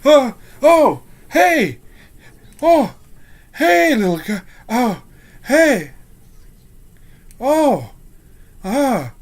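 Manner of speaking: high, loud startled yelps, gradually easing; freaking out. Acted. Guard freaks out, then spots the little guy, startled and easing. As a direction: yelp in fright, then begin to recognise someone. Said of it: "he starts to freak out".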